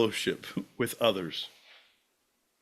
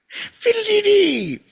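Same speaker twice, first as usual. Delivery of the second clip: very high, loud, drawn-out squeal; whimsical cry; 8 dB louder, slower than usual. Acted. Silly quest companion exclaims, high comic cry. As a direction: sing it out as a silly shriek.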